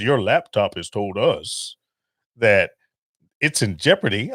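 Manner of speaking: lightly voiced scammer reading a script; mock scam caller. Scam caller reads a warning to the speaker, lightly mimicked.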